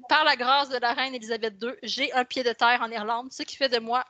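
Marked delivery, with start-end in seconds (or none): none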